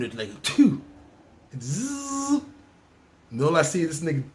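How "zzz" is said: hissing spit noise. Retold or imitated. Spit sound.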